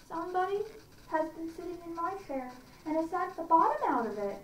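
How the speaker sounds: high little bear voice; squeaky, upset baby bear; little wee bear. A narrator's reading. Narrator voicing the wee bear finding his broken chair, high. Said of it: "in his little small wee voice".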